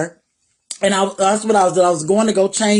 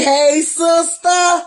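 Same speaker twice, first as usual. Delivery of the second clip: sing-song greeting; 8 semitones higher, much slower than usual. Retold or imitated. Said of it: "when y'all see me say".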